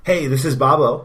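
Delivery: bright promo host voice; promo announcer. Acted. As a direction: hype up the network shows. Network promo read.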